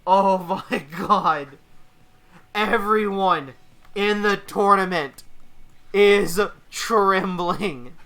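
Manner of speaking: slow, weighty announcement of the winner; slow dramatic call. Acted. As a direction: let each word land. Match ends, dramatic verdict.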